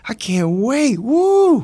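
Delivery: high, loud mock celebration; acted burst of joy; hammed-up excitement. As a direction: whoop it up, climbing to a big "woo!". Acts joy at awaited phone call.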